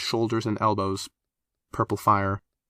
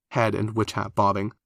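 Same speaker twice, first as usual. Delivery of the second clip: plain reading voice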